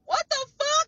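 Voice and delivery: high, loud "what the fuck" of disbelief; shocked that nobody helped her; outraged disbelief. Real, not acted. Ranting about living homeless in her car, outraged disbelief.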